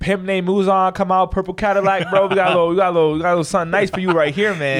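loud, high, hyped street call. Pretend. Cohost loudly hailing an imaginary pimp in a purple Cadillac. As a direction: holler it like flagging down a pimp's Cadillac.

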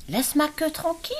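aigu et fort, indigné; écureuil qui proteste; écureuil en colère. A narrator's reading. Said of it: "cria l'écureuil". (l'écureuil proteste, très aigu)

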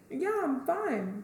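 put-on drawled, high little-girl "I'm fine"; slow innocent cousin. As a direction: act the cousin pretending nothing's wrong. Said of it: "And she was like".

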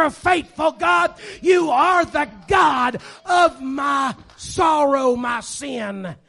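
loud, high, slow prayer of praise; shouted praise in advance. Retold or imitated. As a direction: shout faith ahead of time, drawn out. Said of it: "you get to the point and say".

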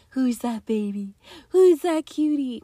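put-on high, sing-song baby talk from a doting parent; cooing parent. Parents cooing at their baby in baby talk. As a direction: coo it like a parent over a crib.

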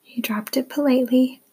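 whispering. Shown as a demonstration. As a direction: breathe the line out, no voice. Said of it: "whispered take of repeated line".

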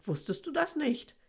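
lightly acted peeved big brother; annoyed. A narrator's reading. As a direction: ask with mild disbelieving irritation. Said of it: "sagt Anton genervt".

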